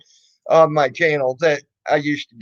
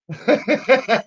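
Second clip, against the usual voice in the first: amused laugh; 6 semitones higher than usual. Real, not acted. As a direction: laugh off the troll. Laughing at the troll.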